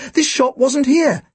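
put-on high, slowed boyish protest; high-pitched indignant claim; exclaiming. A narrator's reading. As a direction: insist in a high, emphatic voice. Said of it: "Tim exclaimed".

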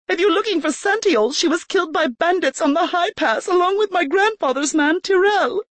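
put-on high, panicky young woman; panicked. A narrator's reading. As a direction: let the panic show. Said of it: "panic came through in her voice".

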